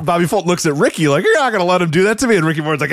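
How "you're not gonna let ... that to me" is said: high, fast whiny plea; mock whine. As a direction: whine it fast like a hurt old-timer. Old wrestler pleading, retold.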